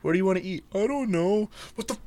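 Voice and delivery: high, whiny voices trading lines; mocking whiny back-and-forth. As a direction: play both sides of a petty argument. Mimicking girlfriend dinner argument.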